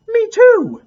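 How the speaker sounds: put-on high, loud "me too" from excited kids; squeaky loud kid voices; eager chorus. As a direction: chime in like thrilled children. Three friends chime in eagerly.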